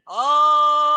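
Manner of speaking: very loud open ah, high; saying ahh. Shown as a demonstration. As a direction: belt out a big ahh. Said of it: "we'll do it again".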